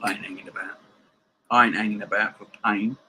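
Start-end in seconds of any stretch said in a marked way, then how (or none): 0.0-0.8 breathy whisper to himself; barely voiced, under the breath. Real, not acted. Whispered to self, then repeated.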